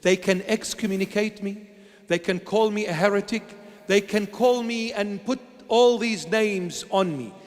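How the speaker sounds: ordinary talk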